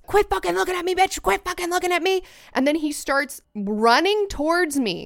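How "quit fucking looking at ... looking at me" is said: high-pitched, repetitive mock rant; imitated yelling. Guest quoting the man after eye contact, high mock yell. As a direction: imitate a stranger yelling on one high note. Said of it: "And he goes".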